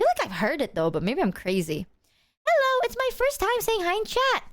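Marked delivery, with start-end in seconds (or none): 2.5-4.4 high, sweet newcomer voice; reading chat. Retold or imitated. Reading a new chatter's first hello, high and sweet. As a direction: read it like a shy first-timer. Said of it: "reading a chat message".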